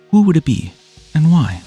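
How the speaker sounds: ordinary talk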